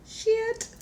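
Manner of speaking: high, sharp dismayed curse. Retold or imitated. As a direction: curse at the sudden downpour. Seeing the torrential rain arrive.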